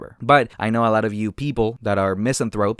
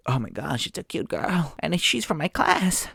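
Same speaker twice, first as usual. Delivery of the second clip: higher, awestruck nerd voice rising to the end; mock nerdy gamer; 8 semitones higher than usual. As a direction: play the dazzled gamer boy. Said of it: "And he's like".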